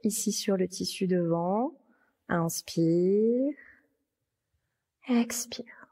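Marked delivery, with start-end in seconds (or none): 2.3-3.5 long, drawn-out breathing cue; consigne d'inspiration. Real, not acted. Breathing cue in yoga class. 5.1-5.9 airy whisper to lead the breath out; whispered exhale cue. Real, not acted.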